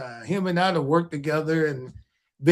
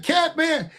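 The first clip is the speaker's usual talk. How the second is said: retold shout; 8 semitones higher than usual. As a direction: yell it across the hall. Brock shouting at him, retold.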